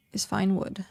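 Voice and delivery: normal talking voice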